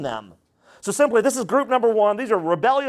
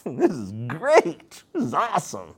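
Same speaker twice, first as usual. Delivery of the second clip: mock joy; 3 semitones lower, 3 dB quieter than usual. Rocky-ground hearers receiving the word, mock joy. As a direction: gush with put-on joy, without raising volume. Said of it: "they immediately receive it with joy".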